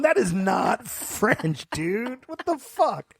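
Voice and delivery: high, very slow mock protest; retold outrage, pitched high; exasperated disbelief. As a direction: stretch it out in incredulous objection. Retold exasperation at brother's fake French.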